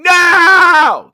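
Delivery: high, very loud screamed no; mock scream. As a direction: scream it like a street protester.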